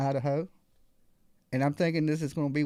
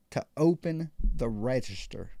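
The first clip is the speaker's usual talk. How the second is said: 1 menacing robber; 5 semitones lower, slower than usual. Retold or imitated. Robber repeats demand at gunpoint.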